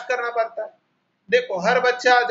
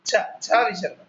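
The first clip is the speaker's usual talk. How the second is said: छ said with force and a breathy release; stressed chha. Shown as a demonstration. Teacher stresses छ for the Hindi six. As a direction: hit छ hard with a visarga puff. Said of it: "छ के ऊपर पर थोड़ा जोर देकर".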